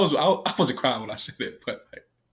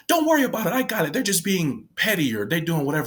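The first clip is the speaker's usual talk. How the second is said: higher-pitched dismissive coworker; coworker's brush-off; 6 semitones higher than usual. Retold or imitated. Coworker telling the speaker she's got it, higher-pitched brush-off. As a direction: wave it away in a raised pitch.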